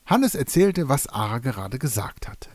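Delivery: usual speaking voice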